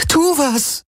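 very high, desperate cry; helpless plea. A narrator's reading. Crewmate helplessly demands action, desperate cry. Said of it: "zitternd vor Hilflosigkeit".

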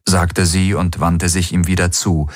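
plain reading voice

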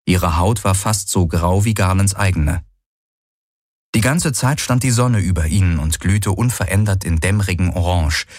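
plain reading voice

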